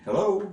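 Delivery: "hello" climbing high on the second syllable; rising iambic stress. Shown as a demonstration. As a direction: push "hello" upward at the end. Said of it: "You see me going up at the end".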